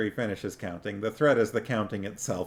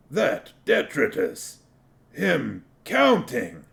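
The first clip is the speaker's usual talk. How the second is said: amazed troll; 5 semitones higher, 4 dB louder, slower than usual. A narrator's reading.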